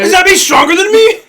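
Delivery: high, hammed-up cartoon question; mock startled question. Cell talking to himself, high mock startled question. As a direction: pitch it way up, imitating.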